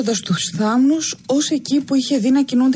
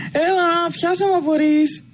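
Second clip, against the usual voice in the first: put-on teasing child; 6 semitones higher, slower than usual. A narrator's reading.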